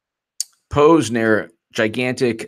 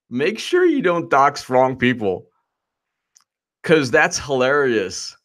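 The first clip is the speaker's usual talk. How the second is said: ordinary talk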